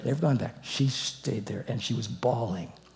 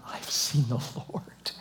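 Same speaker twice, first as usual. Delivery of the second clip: hushed, unvoiced, slow; awed whisper; 6 dB quieter, slower than usual. Mary telling the disciples, in an awed whisper. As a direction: breathe it out as a hushed secret. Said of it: "She said to them".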